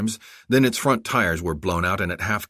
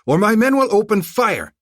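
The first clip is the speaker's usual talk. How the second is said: angry warning; 8 dB louder than usual. Acted. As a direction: warn him one last time. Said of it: "Zolkadar radioed back".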